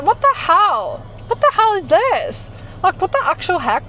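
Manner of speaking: high, rising disbelief; stunned, what-is-this tone. Retold or imitated. Showing her friend the follow request, high and disbelieving.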